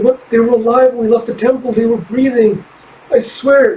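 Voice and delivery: pitched high, insisting through disbelief; a young girl swearing it's true, close to tears; high fox-girl voice, protesting. Acted. Kippa protesting the children were alive.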